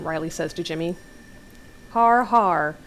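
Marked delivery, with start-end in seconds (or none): 1.9-2.8 loud, spoken fake laugh; feigned laugh. A narrator's reading. Jimmy reacting to Riley's joke, feigned laugh. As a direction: say the laugh as words, sarcastically. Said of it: "Jimmy feigns a laugh".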